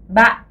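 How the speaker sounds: ba falling and cut short in the throat; glottal falling ba. Shown as a demonstration. Teacher models ba with a heavy, glottalised fall. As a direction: drop ba, then stop it hard in the glottis. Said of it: "ton tombant, glottalisé".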